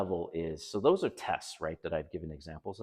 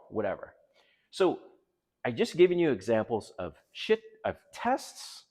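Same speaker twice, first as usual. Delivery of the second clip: ordinary talk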